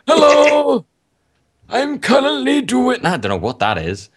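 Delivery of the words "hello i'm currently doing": put-on high, strained old man voice. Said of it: "give him an old man voice".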